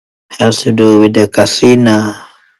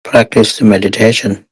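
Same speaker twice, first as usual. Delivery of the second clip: guided meditation. Real, not acted. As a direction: guide meditators step by step, unhurried and even.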